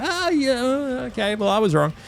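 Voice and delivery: high, put-on backing down; mock sheepish concession. Imagining himself conceding after his giant rant, mock sheepish.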